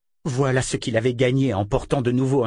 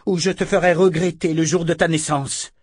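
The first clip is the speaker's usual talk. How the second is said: rugissement menaçant; 7 semitones higher, 4 dB louder than usual. A narrator's reading. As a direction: menace rugie, plus aiguë. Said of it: "rugit Verna". (Verna rugit contre Richard)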